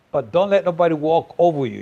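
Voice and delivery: ordinary delivery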